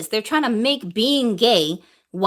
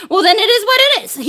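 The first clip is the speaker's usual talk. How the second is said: high, loud, laughing shrug; 9 semitones higher, 5 dB louder than usual. Real, not acted. Brushing off people who won't change, laughing.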